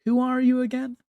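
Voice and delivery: high, casual, dismissive; mocking young man. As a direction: toss it off carelessly. Young man mocks empress.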